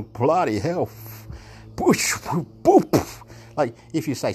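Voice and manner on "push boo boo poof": mock blast noises. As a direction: puff it out like a cartoon blast. Imitating blast sounds.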